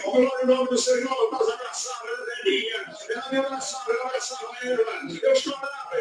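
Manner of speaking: normal talking voice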